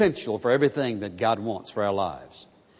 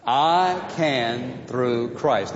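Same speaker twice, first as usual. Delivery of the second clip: very slow, word by word, leading the room; slow unison recital; much slower than usual. Shown as a demonstration. Pastor leading the congregation in a slow unison recital. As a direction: lead the congregation through it slowly.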